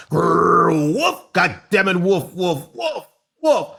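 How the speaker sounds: dog barks. Pretend. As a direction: bark like a goofy dog.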